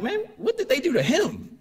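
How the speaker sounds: high, disbelieving cry of surprise; incredulous dad. Retold or imitated. To the teacher about his son, a high incredulous protest. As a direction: sound like a father who can't believe the teacher.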